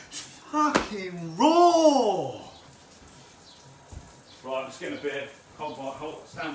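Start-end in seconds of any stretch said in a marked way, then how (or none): 0.1-2.3 high, loud, slow dragged-out yell; real outburst of frustration; exasperated shout. Real, not acted. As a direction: howl it out in dismay. Discovers food raw.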